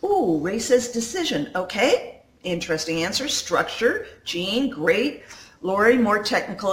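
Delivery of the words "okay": usual speaking voice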